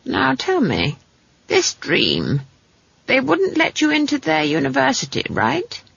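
a hint of a shrewd granny, rising to the question; lightly voiced probing old witch. Granny questions the girl's dream, lightly probing. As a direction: probe knowingly, ending high.

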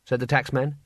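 usual speaking voice

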